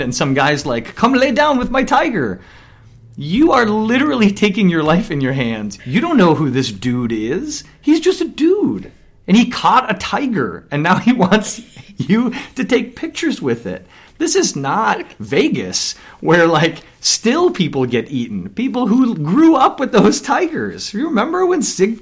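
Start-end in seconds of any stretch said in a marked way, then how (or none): 1.0-2.4 high-pitched mock hawker's invitation; tiger tout. Tiger owner luring a tourist to lie down, mock-eager. 3.2-22.0 worked-up, high-pitched rant; fired up. Real, not acted. Warning a friend off tourist tigers, really fired up. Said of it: "So few things get you really fired up".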